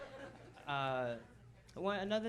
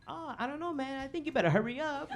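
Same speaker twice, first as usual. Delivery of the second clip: teasing; 8 semitones higher, 4 dB louder than usual. Retold or imitated. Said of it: "And I was like".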